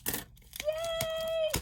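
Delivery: very high, drawn-out squeal; gleeful cheer. Real, not acted. Opening gifted squares, gleeful cheer.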